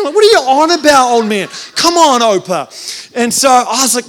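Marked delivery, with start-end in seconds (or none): 0.1-3.1 loud, higher-pitched mock exasperation; exasperated protest. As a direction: scold grandpa in mock disbelief. Retold bickering with his grandfather.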